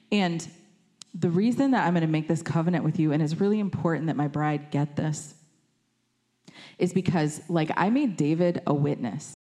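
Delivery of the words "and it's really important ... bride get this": normal talking voice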